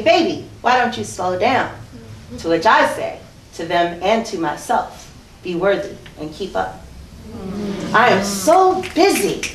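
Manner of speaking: spoken-word poem, emphatic and rhythmic; recital de poesía. Acted. Recites her poem on stage.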